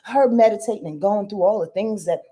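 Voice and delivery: normal talking voice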